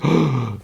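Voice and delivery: high sharp gasp; a retold gasp of the crowd; mock crowd gasp. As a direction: gasp in shock like the wedding guests.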